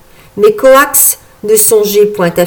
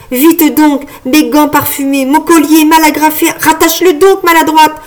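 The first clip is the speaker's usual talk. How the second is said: high, hurried ordering; impatient scolding; 6 semitones higher than usual. A narrator's reading. Frog fairy being dressed by Marguerite, impatient. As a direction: scold a clumsy helper.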